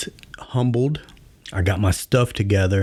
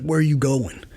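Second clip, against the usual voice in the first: sergeant major; 7 semitones higher than usual. Retold or imitated. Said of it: "He's like".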